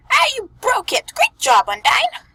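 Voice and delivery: high, loud panicked squeak; terrified squeak. Acted. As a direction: squeak it out in terror. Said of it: "A male's voice squeaked in terror".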